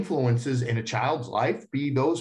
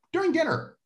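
lightly voiced parent, much higher pitch; parent laying down rules; 10 semitones higher than usual. Parent telling the kids the dinner rule, lightly voiced. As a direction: announce the house rule in a raised pitch.

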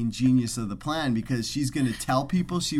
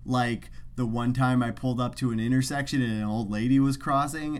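usual speaking voice

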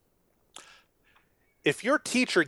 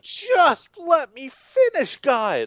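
higher, very slow plea; exasperated pastor; 9 semitones higher, much slower than usual. Retold or imitated. Pastor pleading with the congregation to let him finish, slow high plea. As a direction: drag it out, begging for patience.

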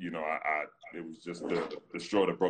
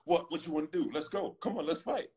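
mock fight taunt, much higher and climbing; animated taunting; 10 semitones higher than usual. Rival player held back by teammates, taunting higher and higher. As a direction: rile him up, daring him to swing. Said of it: "gets real animated".

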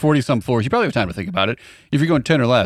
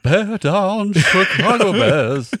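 slow, drawn-out bear voice; 8 semitones higher, much slower than usual. Pretend. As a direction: chant it like a mascot bear. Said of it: "you don't sound like a guy".

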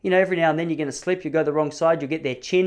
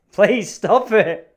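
laughing plea; 7 semitones higher, 7 dB louder, much slower than usual. Retold or imitated. Panicked plea while laughing.

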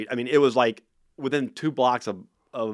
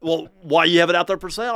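raised, higher mock complaint; annoyed caller; 8 semitones higher, 8 dB louder than usual. Angry caller asks about the car, raised mock annoyance. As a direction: play the irritated caller, a touch hammed-up. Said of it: "they were getting mad at me".

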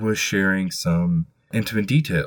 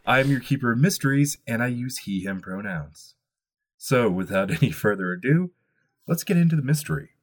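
ordinary delivery

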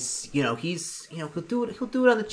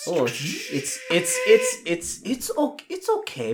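rising doctor voice; 5 semitones higher, much slower than usual. Acted. Doctor inspecting bad arm.

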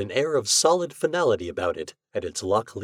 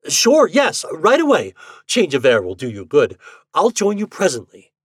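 high, loud, falsely hearty; over-eager agreement; 6 semitones higher, 8 dB louder than usual. Acted. Husband hurries wife away from the ghost, over-eager. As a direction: urge her out with overdone heartiness.

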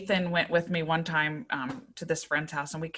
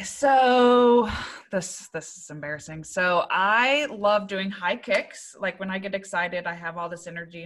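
ordinary talk, nothing marked

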